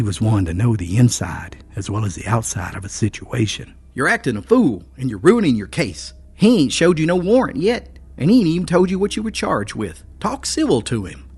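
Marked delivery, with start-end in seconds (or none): 3.9-11.2 higher, louder urgent scolding; urgent storekeeper, lightly voiced. A narrator's reading. Storekeeper scolds his friend urgently, higher voice. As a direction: talk sense into him fast. Phil's character voice.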